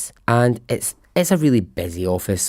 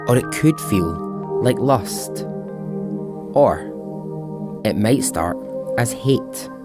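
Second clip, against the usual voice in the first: dramatic teaser read. Acted. Dramatic music-backed podcast teaser.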